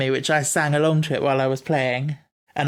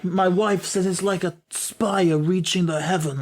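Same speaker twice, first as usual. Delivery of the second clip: put-on slower, higher, clumsy New York accent; bad New York accent; 4 semitones higher than usual. Imitating a workman on the building, terrible New York accent. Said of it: "I'm terrible at that accent".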